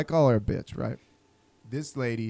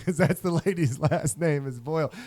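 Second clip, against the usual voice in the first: ordinary talk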